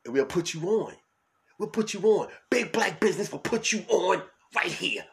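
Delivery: high, loud hype ad voice; promo read. Acted. Host urging listeners to leave voicemails, high loud promo read. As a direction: pitch the voicemail like a radio promo. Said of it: "ad read for voicemail".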